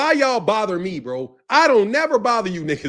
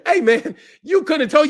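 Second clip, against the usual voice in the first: usual speaking voice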